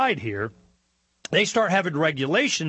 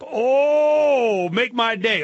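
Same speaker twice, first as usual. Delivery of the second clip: mock dare; 8 semitones higher, 5 dB louder, much slower than usual. Mocking the NRA endorsement.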